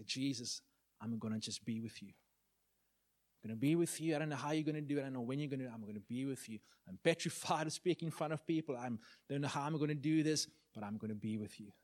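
hushed, intimate surrender to God; relaxed, peaceful prayer. Retold or imitated. Young man praying to Jesus after wrestling, quietly at peace. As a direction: speak softly to Jesus, letting the struggle go. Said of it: "peace came as I started to just relax".

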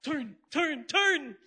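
mock panicked wake-up call; urgent warning. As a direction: pull the driver back from the cliff. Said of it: "It's like".

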